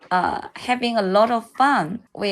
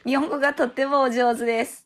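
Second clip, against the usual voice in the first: usual speaking voice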